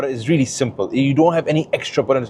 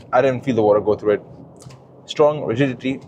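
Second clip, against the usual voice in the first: normal talking voice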